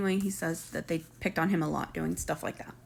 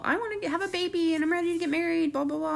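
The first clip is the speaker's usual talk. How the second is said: much higher, fast mock whine; mocking Brian; 8 semitones higher, faster than usual. Vlogger mocking Brian's plans for a baby, high fast mock voice. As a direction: rush it up high, making fun of him.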